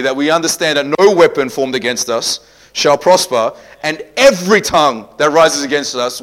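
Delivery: loud, high preaching; fiery preaching. Real, not acted. Pastor preaching victory to the congregation at full force.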